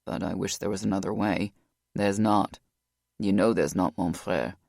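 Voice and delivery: deep and subdued; low, heavy male voice. A narrator's reading.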